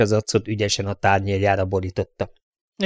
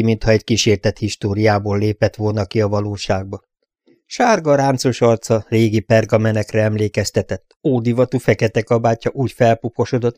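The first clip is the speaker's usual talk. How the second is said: ordinary delivery, nothing marked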